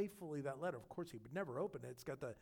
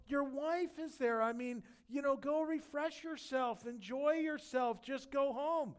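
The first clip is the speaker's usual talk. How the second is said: high, loud, coaxing push to go home; eager urging; 10 semitones higher, 6 dB louder than usual. Retold or imitated. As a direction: talk him into it, brightly insistent. David urging Uriah to go home.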